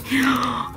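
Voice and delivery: high gasp of disbelief; excited gasp. Real, not acted. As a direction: gasp in delighted shock. Freaking out over a find.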